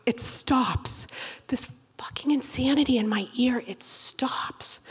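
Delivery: soft breathy whisper of relief; relieved whisper. Retold or imitated. Comedian reliving the ear ordeal, relieved whisper. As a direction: hush it like the storm just passed.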